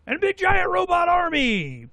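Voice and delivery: big giant robot army, shouted high and loud in mock alarm; a playful mock-alarmed shout; mock dramatic alarm. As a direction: ham up the threat like a movie trailer.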